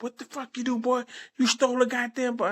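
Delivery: high-pitched mock-mom outrage; scolding mother. Son retelling his mom finding the pictures, high scolding imitation. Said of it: "My mother said".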